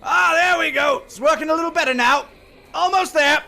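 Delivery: high, loud in-character calls; strained character voice. Acted. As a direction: call out urgently from across the room. Game master voices character working hard.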